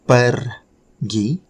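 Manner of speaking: "Pergi" split after a trilled R; rolled R. Shown as a demonstration. Teacher sounding out the verb for note-takers, rolled R in "Pergi". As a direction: roll out "Per", pause, then "gi". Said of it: "challenging to pronounce the R".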